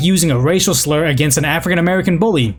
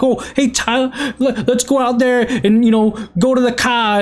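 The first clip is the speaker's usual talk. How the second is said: higher-pitched, hammed-up Boston accent; mock Boston movie accent; 6 semitones higher than usual. Coworker mocking his voice in front of others, hammed-up Boston accent. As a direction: imitate a horrendous movie Bostonian. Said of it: "very horrendous, like, Boston movie accent way".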